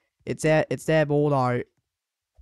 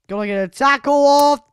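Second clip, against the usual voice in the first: higher, louder urgent call; urgent shout; 10 semitones higher, 9 dB louder, faster than usual. Real, not acted. Gamer urges himself to make a tackle, loud and high. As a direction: urge the tackle, loudly. Urgent during game defence.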